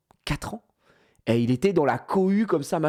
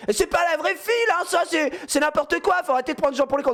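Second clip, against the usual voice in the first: mock shouting; 11 semitones higher than usual. Man in the queue shouting so security hears, high mock shout. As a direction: yell it over the crowd in protest. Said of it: "il entend le mec gueuler".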